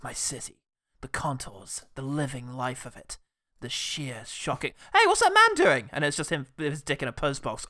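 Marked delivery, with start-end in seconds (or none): 0.0-4.7 quiet, low brooding narration; mock-noir inner monologue; gritty monologue. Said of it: "one of his gritty monologues". 4.9-5.9 high, loud interrupting yelp; sudden high-pitched shout of alarm; startled bystander. Acted. Shocked bystander interrupts the monologue.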